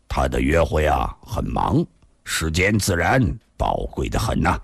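low, detached understatement; dry, unconcerned reply; indifferent, mild. A narrator's reading. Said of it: "淡淡地说道".

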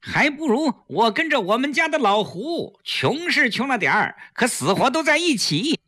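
high-pitched, showy declaration; pointedly for eavesdroppers. A narrator's reading. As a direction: say it for the listener outside. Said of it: "故意看了外面一眼". (故意高声说给外面的人听)

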